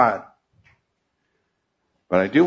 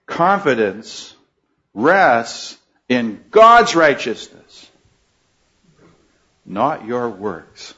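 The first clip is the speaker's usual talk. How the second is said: slow emphatic; slower than usual. Real, not acted. Preacher's slow summing up.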